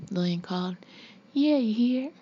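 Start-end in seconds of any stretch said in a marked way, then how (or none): 1.3-2.1 higher, mock Southern lilt; mocking Southern accent. A narrator's reading. Husband parrots wife's farewell, mocking Southern accent. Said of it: "mocking his wife's Southern accent".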